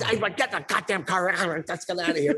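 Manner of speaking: mock angry father; fed-up dad. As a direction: storm off.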